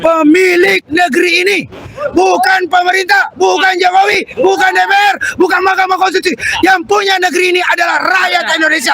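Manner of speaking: rousing protest oratory; rally the crowd like a revolutionary; fiery revolutionary speech. Acted.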